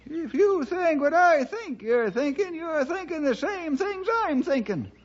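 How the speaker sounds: put-on high, cranky old-timer voice; grumbling old-timer pressing his partner for answers; old sidekick. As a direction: play the fussy old ranch hand, pitched high.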